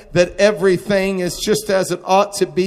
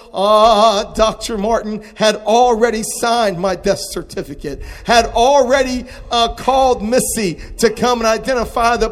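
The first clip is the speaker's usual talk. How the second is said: loud, high, driving sermon cry; full-force preaching; 6 semitones higher, 6 dB louder than usual. Acted. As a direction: preach it out loud to the whole church. Preaching at full force over music.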